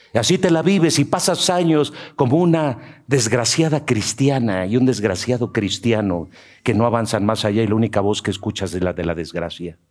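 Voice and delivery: ordinary talk, nothing marked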